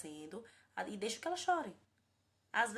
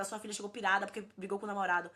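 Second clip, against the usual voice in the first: ordinary talk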